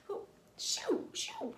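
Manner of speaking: squeaky high shoo, shoo, brushing off a bug. Acted. Child swatting at pesky insects, high squeaky shoo.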